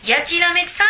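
high, loud call for a doctor; a high loud cry for help; shouting. A narrator's reading. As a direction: call out into the street. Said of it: "alors je crie".

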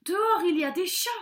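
fast, high, loud warning; alarmed cry. A narrator's reading. Father warns his son about cats outside, crying out in alarm. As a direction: blurt out the danger. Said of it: "s'écria son père".

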